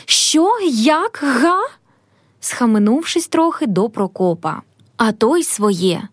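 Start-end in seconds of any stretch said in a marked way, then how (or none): 0.0-1.6 high-pitched, incredulous little cries; outraged disbelief, voice jumping up; angry astonishment. A narrator's reading. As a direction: exclaim each question in indignant amazement. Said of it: "у гніву, у диві великому, тільки викрикує".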